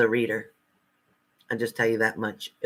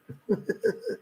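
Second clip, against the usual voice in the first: breathy laugh; 9 semitones higher, 6 dB quieter than usual. Real, not acted. Laughs at misspelled report.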